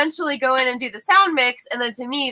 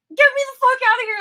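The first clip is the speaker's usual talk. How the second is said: exasperated; 14 semitones higher, faster than usual. Retold or imitated. Fed up with endless edit loop.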